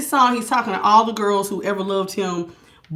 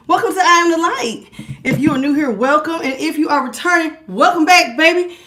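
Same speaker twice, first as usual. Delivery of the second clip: higher, brighter host greeting, pushed up; energetic welcome; 6 semitones higher, 4 dB louder than usual. Real, not acted. Host restarting her stream intro, upbeat welcome. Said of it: "All right, my bad".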